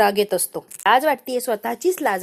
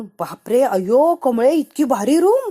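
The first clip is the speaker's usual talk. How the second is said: awed delight; 3 semitones higher, 5 dB louder, slower than usual. Acted. Village girl marvels at the rich girl's room, thrilled. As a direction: gush over the fancy room. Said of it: "ती room वगैरे बघून".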